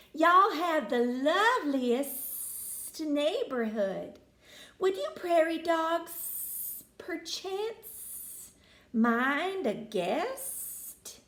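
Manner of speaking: a snake trying very hard to be polite; genteel snake. Acted. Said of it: "Danny hissed".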